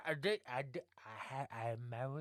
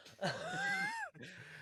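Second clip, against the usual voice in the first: high crying laugh; 5 dB quieter than usual. Real, not acted. Laughs at own voice.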